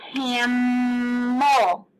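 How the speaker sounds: "Camel" with the M held long; slow cammm-mel for "Camel"; stretched-out consonant. Shown as a demonstration. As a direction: hum through the M of "Camel". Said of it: "We are stretching at that M".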